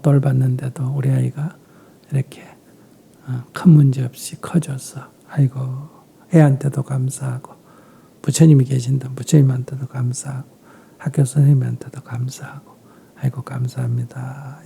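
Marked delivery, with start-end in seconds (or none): 5.3-14.6 softer and softer, fading to a whispered 감사합니다; a hushed, devout murmur of gratitude; whispered thanks. Retold or imitated. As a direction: murmur a prayer of thanks to yourself. Parent's thankful prayer quoted.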